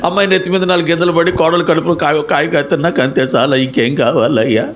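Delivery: imitating a whiny, wailing plea; hammed-up sobbing petition to God; mock tearful prayer. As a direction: put on a wobbly crying chant, running the complaints together. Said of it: "చాలామంది ఏడుపులు".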